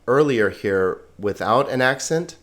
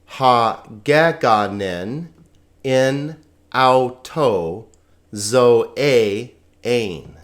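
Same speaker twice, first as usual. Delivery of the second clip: slow, measured reading in short word groups; deliberate, drawn-out syllables with small pauses; slow read-together; much slower than usual. Shown as a demonstration. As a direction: lead a class reading Greek aloud slowly. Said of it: "Let's just read that all together now".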